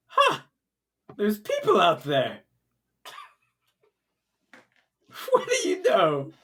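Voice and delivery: mad king voice. Acted. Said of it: "he goes".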